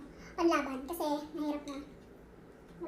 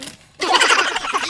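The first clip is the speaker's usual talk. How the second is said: loud laugh; 16 dB louder than usual. Real, not acted. Laughing at spicy noodle pack.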